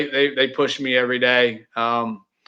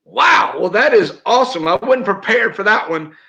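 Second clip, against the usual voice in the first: high, loud wow easing into a surprised admission; pleasantly surprised; 6 semitones higher, 3 dB louder than usual. Real, not acted. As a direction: react high-pitched to an unexpected question. Caught off guard by question.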